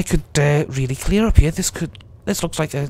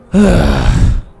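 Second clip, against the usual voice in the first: heavy, loud exhale; loud sigh; 8 dB louder than usual. Real, not acted. Player expecting a worse day, loud sigh. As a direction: let out a big noisy sigh.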